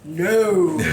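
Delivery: mock-godly drawn-out pronouncement; hammed-up voice of an offended deity; pastry gods. Pastry gods answer the offending baker, mock-solemn. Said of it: "the pastry gods will come back and say".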